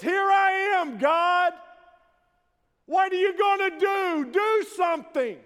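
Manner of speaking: very high, very loud taunting shout; hollering dare. Acted. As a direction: holler up at the sky, goading. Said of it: "hollering at God".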